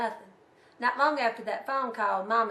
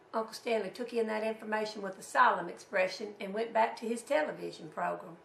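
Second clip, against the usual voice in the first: plain reading voice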